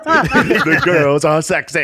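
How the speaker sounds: telling his boys, half-laughing mock brag; mock excited brag. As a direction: gloat it through laughter. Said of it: "He looks back at his boys like".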